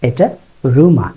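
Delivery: রুমা said slowly as "roo-ma"; no "yoo" in রুমা; রুমা with a long "ma"; correct rumour. Shown as a demonstration. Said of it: "রিউমারের উচ্চারণ রিউমার না".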